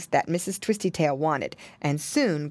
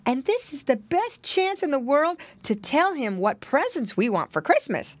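put-on excited piggy boy; 10 semitones higher than usual. A narrator's reading. Piglet telling brother of their chance, excited. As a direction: gush to your brother in a piglet voice. Piglet thrilled at finding Santa.

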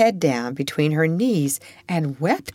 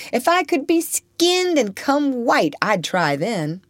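defiant Topsy; 9 semitones higher than usual. Acted.

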